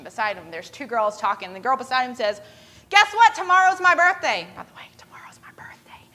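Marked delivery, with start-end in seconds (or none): 2.9-4.5 high, loud birthday announcement; excited announcement. Retold or imitated. Girl tells her friend about her birthday, excited. 4.6-6.0 quick, very soft whisper; whispered aside. Real, not acted. Speaker slips in own birthday to listeners, whispered aside.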